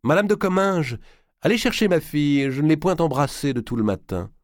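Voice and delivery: calling out. A narrator's reading. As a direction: call out across the rooms. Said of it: "elle cria en direction de la pièce voisine".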